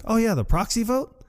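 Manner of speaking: high-pitched imitated reply; retold excuse, lifted high; mock innocent explanation. Voices Bob explaining himself.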